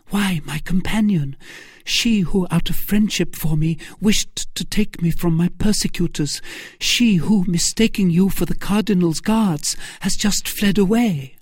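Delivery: high-pitched, innocent heroine; high lady's voice, pleading to be understood; earnest young woman. Acted. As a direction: explain eagerly in a high voice.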